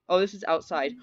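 usual speaking voice

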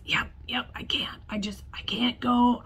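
whispered, faking a lost voice; a put-on whisper to sound sick; fake lost voice. Student insisting on the phone, faking a whisper. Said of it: "I've lost my voice".

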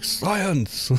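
high, drawn-out science cry; Magnus Pike sample. Retold or imitated. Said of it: "a sample of him going".

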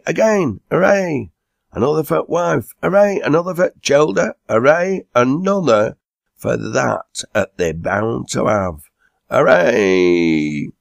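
high, loud rounds of hip hip hooray; cheering. A narrator's reading. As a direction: lead the pub in hearty cheers. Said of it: "sang and cheered with the rest".

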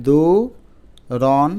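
দু, রন as separate loud syllables with gaps. Shown as a demonstration. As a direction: sound out দু, then রন. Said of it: "উচ্চারণটা যেভাবে মুখে আসে".

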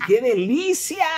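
high, slow, drawn-out commentator cry; mock-commentator delight, slowed and soaring; Ángel Fernández. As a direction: stretch it like a famous play-by-play man. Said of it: "Como diría Ángel Fernández".

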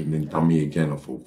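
ordinary talk

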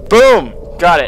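loud, high victory cry; triumphant shout. Real, not acted. Triumph after solving code.

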